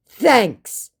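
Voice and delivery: belligerent. Retold or imitated. Scammer's belligerent thanks, voiced mockingly. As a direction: spit out a begrudging thanks. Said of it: "came the belligerent response".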